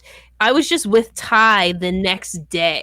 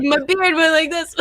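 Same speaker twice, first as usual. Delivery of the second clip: laughing quote; 8 semitones higher than usual. Retold or imitated. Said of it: "And you're like".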